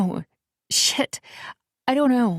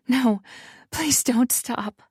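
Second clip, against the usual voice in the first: raised, slow begging; plead, pitched up and slow; higher urgent plea; 6 semitones higher, slower than usual. A narrator's reading. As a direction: heroine urgently begging him not to stop. Heroine pleading.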